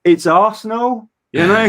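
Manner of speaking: high-pitched, drawn-out disbelief; acted incredulous comeback to a heckler; exasperated retort. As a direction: protest as if it's obvious.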